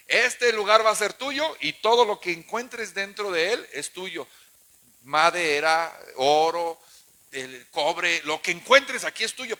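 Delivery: loud, slow preacher's proclamation; loud divine promise; proclaiming a promise. Acted. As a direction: announce it as God's gift. Voices God's promise loudly.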